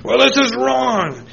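high, loud exclaim; mock shout. Speaker quoting himself protesting to the ranting man, high exclaim. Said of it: "when he's screaming at me".

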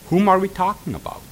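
ordinary talk, nothing marked

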